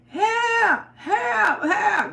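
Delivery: a wife yelling help at the store; retold holler for help. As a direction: call out for help as if held down. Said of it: "I just went to holler".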